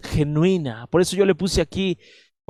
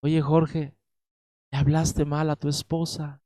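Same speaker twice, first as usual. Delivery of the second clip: soft, gentle correction, much quieter; gentle calm voice; 5 dB quieter than usual. Retold or imitated. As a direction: speak it tenderly like a loving rebuke. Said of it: "con una voz muy apacible". (el Espíritu Santo corrige a Jorge con amor: voz suave y apacible)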